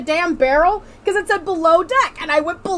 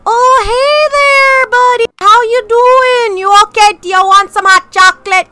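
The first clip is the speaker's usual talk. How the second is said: North Dakotan accent; 4 semitones higher, 11 dB louder than usual. Shown as a demonstration. Demonstrates for friend, offering hot chocolate, loud North Dakotan accent. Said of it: "I will do a North Dakota accent".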